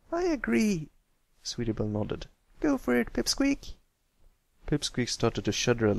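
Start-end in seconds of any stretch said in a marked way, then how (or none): 0.1-0.9 lightly voiced young filly, higher; a small girl pony chiming in; Sweetie Belle's voice. A narrator's reading. Narrator gives Sweetie Belle a higher girl voice. 2.6-3.7 put-on higher young filly voice, bright rise; encouraging filly. A narrator's reading. Filly urging Pipsqueak to tell his story, higher.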